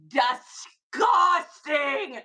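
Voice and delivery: drawn-out, loud, almost a cry; stretched hype shout. Real, not acted. Seller hyping a shirt to viewers, stretched shout. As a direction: stretch the word out in mock disgust.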